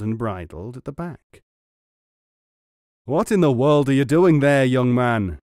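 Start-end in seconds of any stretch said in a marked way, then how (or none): 3.0-5.4 loud, indignant bellow; angry bellow. A narrator's reading. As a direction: bellow it out angrily. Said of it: "he bellowed with many a curse".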